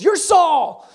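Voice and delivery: high, loud shriek of alarm; the witch screams as she recognizes the king; witch freaking out. Acted. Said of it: "the witch freaks out".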